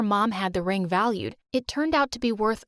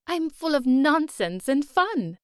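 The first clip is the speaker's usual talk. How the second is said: playful nanny; 5 semitones higher, 3 dB louder, slower than usual. Acted. Fake nanny charming the girl.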